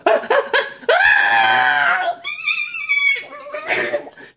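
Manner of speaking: piercing high mock screams of burning people; imitated screaming. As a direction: let out a long shrill imitated scream. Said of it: "And the screaming".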